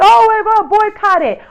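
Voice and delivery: mock their outrage; mocking quote.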